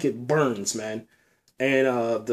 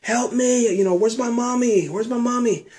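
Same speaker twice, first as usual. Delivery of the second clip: put-on higher pleading child's voice; frightened child; 7 semitones higher than usual. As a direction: beg for help like a scared little kid. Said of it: "He's like".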